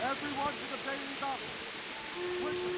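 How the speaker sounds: high, urgent call from a distance; rally everyone to the walls; calling alarm. Acted. Alarm called to defenders.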